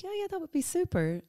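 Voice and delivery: high, fast and thrilled; eager yes. Retold or imitated. Agreeing to FaceTime the lovely lady from Cork, high and quick. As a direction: jump at the offer with delight. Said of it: "So I was like".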